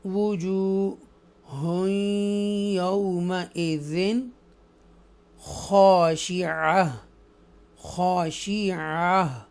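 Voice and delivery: slow drawn-out melodic tajweed recitation; Quran recitation. Shown as a demonstration. Teacher recites the verse for students, slow melodic tajweed. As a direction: chant each word slowly, stretching the vowels.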